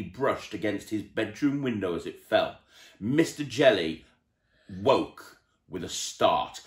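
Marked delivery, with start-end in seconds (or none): none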